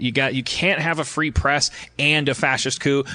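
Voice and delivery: ordinary delivery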